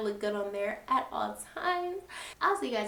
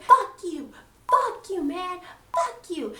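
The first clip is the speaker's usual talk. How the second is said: loud, higher yelled fuck you; angry cursing; 7 semitones higher, 5 dB louder, slower than usual. Retold or imitated. Maria cursing everyone out, loud higher yell. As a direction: hurl it at her, voice jumping up.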